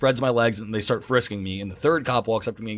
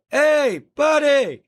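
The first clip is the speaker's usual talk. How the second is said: loud, high-pitched holler; calling out; 13 semitones higher, 6 dB louder, much slower than usual. Retold or imitated. Taxi driver hailing a runner on the street, high shouted call. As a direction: imitate a driver hailing someone from afar.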